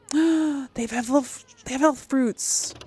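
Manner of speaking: gasp, then blurt it out with excitement; excited gasp. Real, not acted. Player spots health fruits at the shop, excited.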